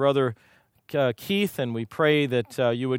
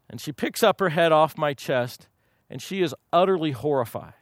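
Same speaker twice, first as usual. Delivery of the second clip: ordinary delivery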